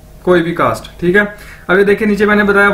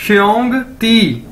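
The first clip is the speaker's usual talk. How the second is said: slow xiongdi; 3 semitones higher, slower than usual. Shown as a demonstration. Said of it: "ठीक है".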